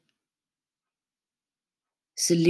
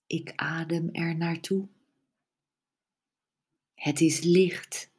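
whispering. Acted. Whispered relaxation cue.

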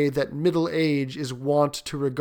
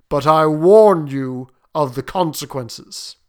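slow, loud and weighty; grave warning; 8 dB louder, slower than usual. Acted. Sergeant major warns his host of the consequences, gravely. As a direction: warn slowly with heavy emphasis.

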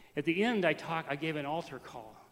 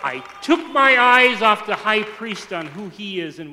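loud, slow, raised preacher's voice; ringing, deliberate confession from the pulpit; full-force preaching; 6 semitones higher, 18 dB louder, slower than usual. Real, not acted. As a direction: proclaim it to the whole church.

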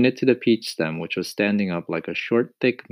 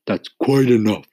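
sharp; 4 semitones higher, 8 dB louder than usual. A narrator's reading. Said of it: "the earthworm said sharply".

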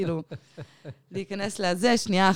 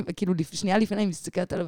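ordinary delivery

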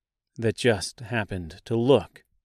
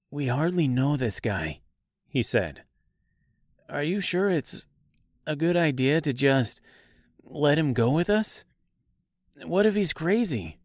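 higher, hushed worried voice; nervous scarecrow aside; 4 semitones higher than usual. A narrator's reading. Scarecrow warning Dorothy about the stranger, higher and hushed. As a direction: fret behind his back.

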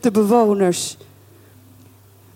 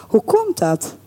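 ordinary delivery

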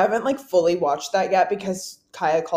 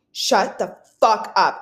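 slow, louder, each word drawn out; retold disbelief; 6 dB louder, much slower than usual. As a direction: act out a stunned fan, word by word. Retells makeup artist's amazed reaction.

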